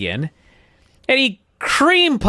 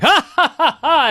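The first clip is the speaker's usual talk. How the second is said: high, loud forced ha-ha; mock fake laugh; 11 semitones higher, 3 dB louder than usual. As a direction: ham up a canned laugh. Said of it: "It's a joke".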